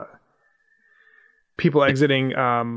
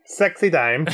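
higher put-on catchphrase voice; Borat; 4 semitones higher, 3 dB louder than usual. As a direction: toss in the catchphrase as an impression. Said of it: "a Borat voice".

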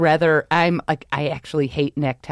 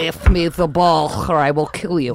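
mock Russian accent; 4 dB louder than usual. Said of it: "I think that's Russian".

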